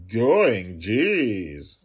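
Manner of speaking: a sulky genie obeying; grumbling genie. Acted. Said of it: "said the genie".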